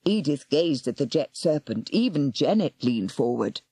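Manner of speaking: usual speaking voice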